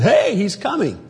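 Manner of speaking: high excited call announcing the bridegroom. Retold or imitated. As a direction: call out the news, pitched high.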